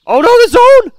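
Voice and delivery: very high, loud cry of alarm; real terrified yelp; panicked shriek. Real, not acted. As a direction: scream it as the zone closes. Trapped by closing zone.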